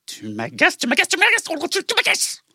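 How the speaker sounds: building irritation, rising; irritated. Acted. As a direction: mad scientist. Villain irritated, repeating.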